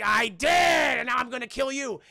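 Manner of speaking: hammed-up villain yell; mock shout. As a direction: bellow the threat like a cartoon villain. Sabretooth threatens Logan.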